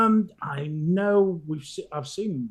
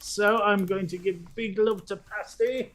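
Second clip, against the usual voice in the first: ordinary talk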